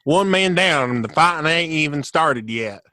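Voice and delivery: a hint of a Southern accent; imitating a Southern accent. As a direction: quote it in a lightly put-on Southern accent. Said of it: "Andrew Garfield's accent".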